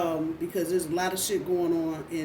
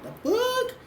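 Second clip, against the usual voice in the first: sudden high squeak of disbelief; mock disbelief. Viewers stunned by the reading, squeaking in disbelief. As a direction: yelp it, stunned. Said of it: "I know y'all like".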